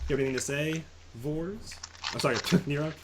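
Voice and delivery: ordinary delivery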